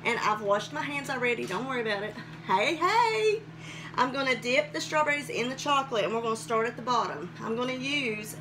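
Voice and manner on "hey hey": excited greeting. Real, not acted.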